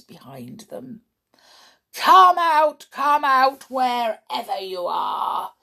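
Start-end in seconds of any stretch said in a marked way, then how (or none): 1.9-2.9 high, loud taunting call; sing-song taunting. Acted. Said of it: "hissed matron". 2.9-5.5 taper off the taunting call; sing-song taunting. Acted. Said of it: "hissed matron".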